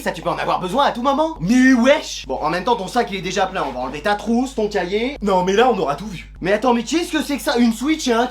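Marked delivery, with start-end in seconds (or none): none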